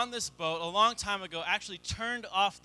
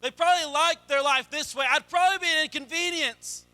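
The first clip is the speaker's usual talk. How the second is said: rising, louder preacher's push; preaching; 6 semitones higher, 4 dB louder than usual. Acted. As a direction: drive the point home to the congregation. Building sermon climax.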